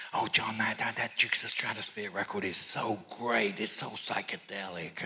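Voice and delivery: a hushed, starry-eyed fan; mock gushing girl's voice; gushing wife. Wife gushing in retold story.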